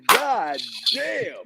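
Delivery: a pained, incredulous complaint; disbelief. Retold or imitated. Retold disbelief at the label.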